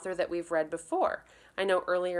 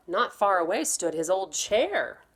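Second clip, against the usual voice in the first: ordinary delivery, nothing marked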